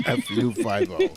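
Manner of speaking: giggling out the line; laughing. Retold or imitated. Repeating quote laughing.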